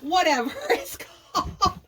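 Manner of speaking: cracking up at forgetting the name; laughing. Real, not acted. Vlogger laughing at herself while hunting for the word.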